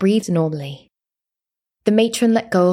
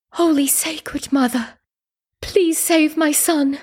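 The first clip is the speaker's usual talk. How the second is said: high, slow imploring prayer; desperate prayer; 9 semitones higher, slower than usual. A narrator's reading. Mother prays to the gods for her son, desperate plea. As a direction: beg slowly, pitch raised. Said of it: "watched my mother pray to the gods".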